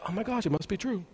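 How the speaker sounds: soft, under-the-breath realization; hushed amazement. Retold or imitated. Speaker recalls his thought on hearing the story confirmed, hushed amazement. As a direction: drop to a quiet aside of wonder.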